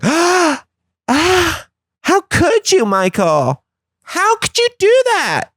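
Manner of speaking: shrill, high scandalized gasping. Retold or imitated. Friends scandalized that Michael ate Chick-fil-A, shrieking high. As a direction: clutch your pearls in horror.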